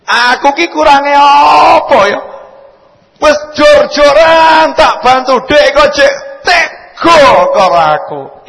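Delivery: loud, high aggrieved wife; wronged wife yelling her hurt; acted complaint. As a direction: shout the grievance to the husband. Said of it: "Ayo, betul enggak?".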